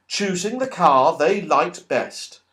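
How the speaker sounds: plain reading voice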